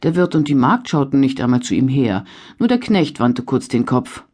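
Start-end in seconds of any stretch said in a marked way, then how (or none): none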